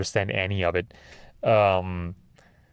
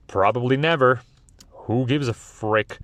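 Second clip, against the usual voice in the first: ordinary talk, nothing marked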